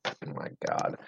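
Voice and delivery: breathy whispered disbelief; exasperated whisper. Real, not acted. Cohost hearing pizza replaces Thanksgiving, exasperated whisper. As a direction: whisper it like you can't believe what you heard.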